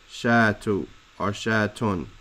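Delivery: "Shatu or shatun" with the endings -u and -un voiced; vowel endings sounded. Shown as a demonstration. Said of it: "putting the vowels on it".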